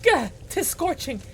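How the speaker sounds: high-pitched, theatrical distress; old-fashioned hero panicking over the heat; flustered archaic knight. Acted.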